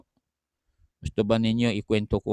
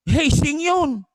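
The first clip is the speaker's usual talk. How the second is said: loud warning; 7 dB louder, slower than usual. Real, not acted. Pastor warning congregation about hazing.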